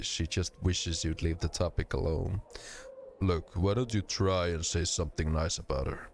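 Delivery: ordinary delivery, nothing marked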